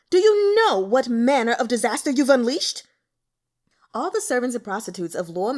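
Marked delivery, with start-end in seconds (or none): 0.1-2.8 much louder furious bellow. A narrator's reading. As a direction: roar it at the fallen woman. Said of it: "he bellowed".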